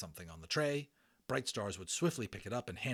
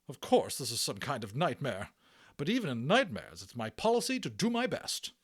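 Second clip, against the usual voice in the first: higher, louder, prim resolve; brisk matron; 5 semitones higher, 6 dB louder than usual. Acted. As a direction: speak like a no-nonsense nurse. Matron resigned but determined.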